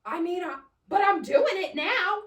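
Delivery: mock husband squirming out of blame; husband's defensive excuse. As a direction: blurt it out, caught off guard. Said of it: "And he's like".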